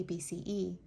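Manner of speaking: ordinary delivery, nothing marked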